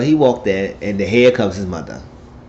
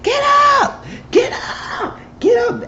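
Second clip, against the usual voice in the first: crazed screaming; slower than usual. Acted. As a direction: scream it over and over like a madwoman. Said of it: "Act like a crazy mad woman".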